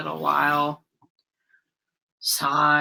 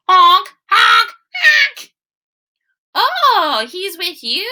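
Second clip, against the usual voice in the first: much higher, loud honking calls; goose honks; 12 semitones higher, 8 dB louder, slower than usual. Acted. Geese honking to calm the mice, high and loud. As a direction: honk like a goose smoothing things over.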